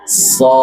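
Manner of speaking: So with an open, unrounded a-like vowel; open mouth. Shown as a demonstration. Teacher modelling the right letter, open-mouthed So. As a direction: open wide on "So".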